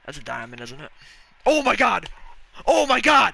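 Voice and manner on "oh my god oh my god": high, very loud disbelieving shout; full-volume shriek of amazement; real excited shouting. Real, not acted. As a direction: explode in a stunned, joyful yell. Pulls rare card, erupts.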